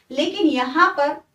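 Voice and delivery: usual speaking voice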